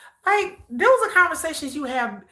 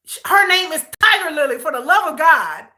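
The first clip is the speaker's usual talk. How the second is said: louder, higher exasperated stress on Tiger; exasperated emphasis; 4 semitones higher, 6 dB louder than usual. Real, not acted. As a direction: hit "Tiger Lily" in disbelief.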